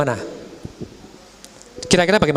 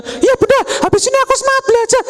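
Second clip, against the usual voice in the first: put-on very high, fast, eager kid; eager child; faster than usual. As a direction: promise to study, bursting with eagerness. (anak bersemangat, nada sangat tinggi dan cepat)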